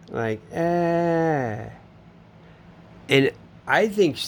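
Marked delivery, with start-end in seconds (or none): 0.5-1.7 high, strained mock sob; imitated crying wail. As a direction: imitate her sudden wail of tears. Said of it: "And she just starts crying".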